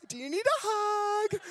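imitating a sweet girl; girl student. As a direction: chirp it like a shy student girl. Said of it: "she's like".

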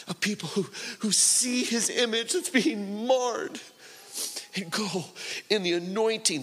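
high-pitched, fervent sermon delivery; real fervent exhortation in a high, straining register; impassioned preaching. Real, not acted. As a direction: preach it urgently, voice lifted well up, stretching key phrases.

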